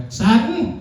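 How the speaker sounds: high, slow mock mom lecture; high-pitched imitation of his mom, slow and preachy; immigrant mom voice. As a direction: ham up a strict Vietnamese mother. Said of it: "she's like".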